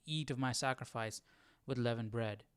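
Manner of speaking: ordinary talk, nothing marked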